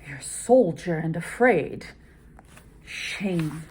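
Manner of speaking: a touch of scornful urging; shaming. Shown as a demonstration. Lady Macbeth shaming the frightened Macbeth. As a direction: mildly push him, half scolding. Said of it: "she shames him".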